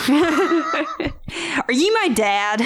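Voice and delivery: slow, drawn-out question through laughter; laughing. Pretend. Cohost echoing the joke, slow and laughing. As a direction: stretch it out while cracking up. Joking riff on The Father.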